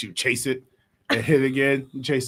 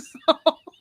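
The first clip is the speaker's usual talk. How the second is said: high laugh burst; 9 semitones higher, 5 dB louder than usual. Real, not acted.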